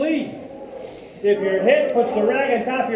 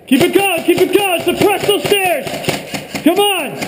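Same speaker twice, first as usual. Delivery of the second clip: battlefield shouting; 6 semitones higher than usual. Real, not acted. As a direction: yell orders over gunfire. Calling suppressive fire in firefight.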